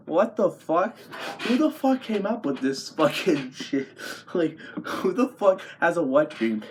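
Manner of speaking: high-pitched, laughing disbelief; incredulous outburst. Real, not acted. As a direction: react with baffled amusement, pitch jumping up. Incredulous reaction to the article.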